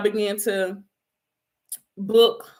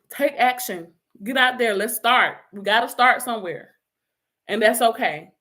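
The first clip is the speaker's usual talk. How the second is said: ordinary talk, nothing marked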